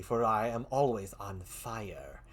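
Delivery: low, slow, grand villain voice; villain boast. Acted. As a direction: deliver it like a smug dark lord. Villain persona retort.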